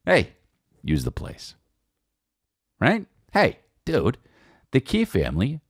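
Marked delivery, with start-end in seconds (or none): none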